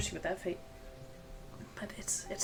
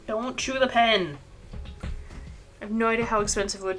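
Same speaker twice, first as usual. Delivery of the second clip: scolding; 3 semitones higher, 5 dB louder than usual. Real, not acted. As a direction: scold the cat, drawing the words out loudly. Scolding cat chewing the pen.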